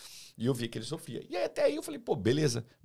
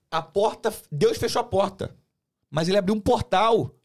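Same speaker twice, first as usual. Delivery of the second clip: higher and louder, proclaiming the open door; fervent exclamation; 8 semitones higher, 8 dB louder than usual. Real, not acted. Telling the podcast hosts about God's open portal, fervent and loud. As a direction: declare it like good news breaking through. Triumphant faith testimony climax.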